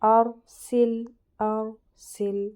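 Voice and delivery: ارسل drawn out very slowly, with a heavy ر; slow Quran recitation. Shown as a demonstration. Teacher repeating the example for students, slow recitation. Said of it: "میں نے را کو کیسا پڑھا؟".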